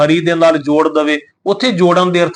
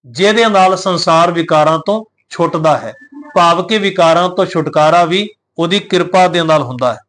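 ordinary delivery

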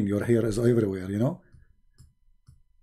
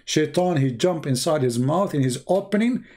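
ordinary talk, nothing marked

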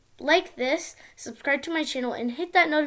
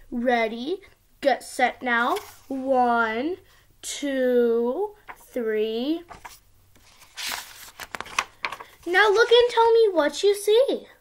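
sing-song storybook reading; reading to a toddler; 6 dB louder than usual. Acted. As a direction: read it like a bedtime picture book. Child reads picture book aloud.

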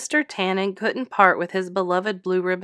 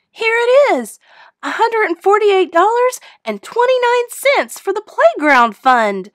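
put-on high, loud proud girl's voice; proud child; 13 semitones higher, 8 dB louder than usual. A narrator's reading. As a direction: announce it beaming with pride. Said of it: "said Kaylee proudly".

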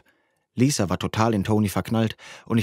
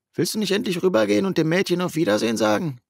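put-on higher, teasing young friend; higher friend's voice, egging him on; buddy Justin's voice; 10 semitones higher than usual. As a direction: play the nudging best mate, pitched up.

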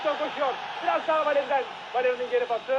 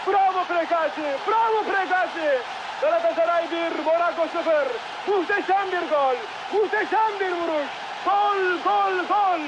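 screaming football commentator; 3 semitones higher than usual. Real, not acted. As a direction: cheer the scorer at full voice. Celebrating the goal live. (coşkuyla bağıran spiker)

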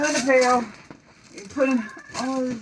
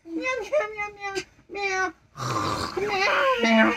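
high, crying mock meows; whiny meows; 8 semitones higher, 4 dB quieter, slower than usual. Reader whines meows at the slow cat. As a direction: whimper the meows like an upset kitten.

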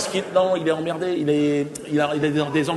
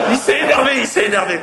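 emphatic outburst; 8 semitones higher, 4 dB louder than usual. Real, not acted. Teller stresses the anger.

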